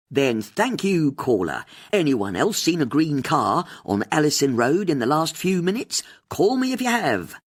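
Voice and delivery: rally the listeners; host appealing to listeners on air; radio host. A narrator's reading.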